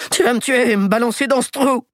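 put-on high, pleading voice of a terrified old crook; panicked old man. Terrified old thief begging the boy not to kill him, high panic. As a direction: beg like you're sure he'll shoot.

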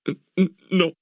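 high, shaky stuttered no; terrified stammer. Acted. Boy stammers after branding.

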